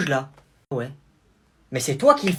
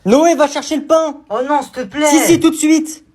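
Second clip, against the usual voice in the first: much higher, louder order; bossy order; 5 semitones higher, 7 dB louder than usual. Acted. Parent ordering Noé to fetch bread, loud bossy. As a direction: send the kid for bread now.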